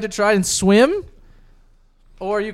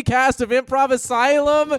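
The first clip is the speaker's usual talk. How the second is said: usual speaking voice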